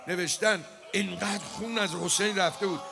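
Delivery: elegy sermon at full force; full preaching. Acted. Preaches Hussein rising on spear.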